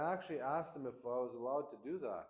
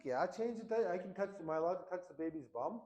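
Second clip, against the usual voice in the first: wife voiced high and bright-eyed; quoting the wife, pitched well up; wife's voice; 7 semitones higher than usual. Man retells his wife urging him to change diapers, pitched well up. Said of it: "She's like".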